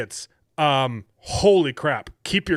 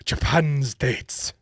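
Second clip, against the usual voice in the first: drawn-out put-on Japanese accent; mock Japanese accent; 3 semitones higher, much slower than usual. Reading the Japan dating ad, mock Japanese accent. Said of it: "How do you do a Chinese accent?".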